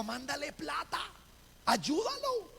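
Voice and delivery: high, calling voice from a dream; voz del sueño. Retold or imitated. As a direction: call out high, holding the last command up. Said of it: "le decía en el sueño a la voz". (voz aguda que ordena en sueños)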